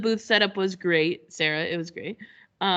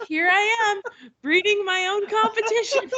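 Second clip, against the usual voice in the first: much higher mock-horrified punchline; mock dismay; 13 semitones higher than usual. Host mocks herself to the cohost, mock-dismayed. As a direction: deliver it as a rueful joke.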